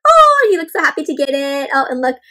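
usual speaking voice

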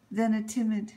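ordinary delivery, nothing marked

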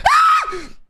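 sudden shrill scream of fright; high startled scream. Real, not acted. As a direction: shriek out of nowhere in fright. Susto em jogo de terror. (grito agudo de susto)

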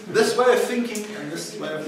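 ordinary delivery

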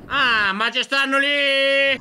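high, loud, drawn-out exclamation; startled shout. Real, not acted. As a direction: cry out on spotting them, stretching the end. Startled sighting in the game.

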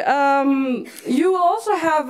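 usual speaking voice

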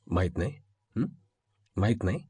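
very low, hushed, threatening questions; quiet menace. Acted. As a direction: echo it back softly, as a threat. Angry boss quietly interrogates subordinate. (दबक्या, धमकीच्या आवाजात)

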